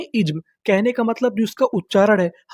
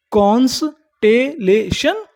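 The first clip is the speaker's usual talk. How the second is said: Constellation in slow pieces, rising at the end; syllable by syllable; much slower than usual. Shown as a demonstration. Teacher prompting learners for the word, slow and rising. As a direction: split constellation slowly into कॉन्स-टे-ले-शन. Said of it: "क्या बोलते हैं".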